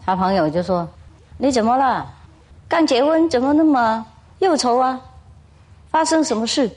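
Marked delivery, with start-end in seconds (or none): 1.4-6.7 a friend fussing over the tearful new bride; concerned friend. Acted. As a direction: ask with mock concern, pitched up. Friend asks the weeping bride.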